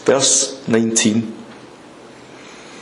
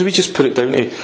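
ordinary talk

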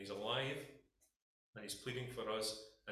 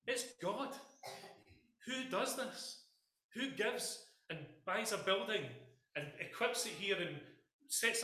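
rising preaching fervour; 7 semitones higher than usual. Acted. Preacher building rhetorical questions.

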